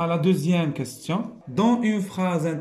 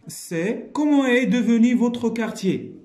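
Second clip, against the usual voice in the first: slow, raised reading voice; slow emphatic reading; reading aloud; 8 semitones higher, 4 dB louder, slower than usual. Shown as a demonstration. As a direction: read the question out to pupils. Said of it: "reading question".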